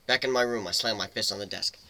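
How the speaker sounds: ordinary delivery, nothing marked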